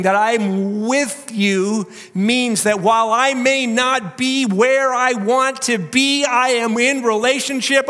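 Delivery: loud, high, slow preaching cry; soaring pulpit declaration; full-force preaching. Acted. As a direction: proclaim it over the room.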